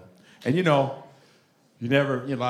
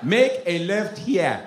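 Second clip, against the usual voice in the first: put-on slow Nigerian-accented directions; drawn-out mock Nigerian satnav; Nigerian GPS voice; 4 semitones higher, much slower than usual. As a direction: give the turn slowly in a Nigerian accent. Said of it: "You couldn't have a Nigerian on a G P S".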